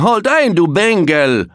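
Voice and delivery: loud, high guard's shout; shouting at trespasser. Acted. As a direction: bellow a stern halt at the gate. Gate guard calls out to intruder.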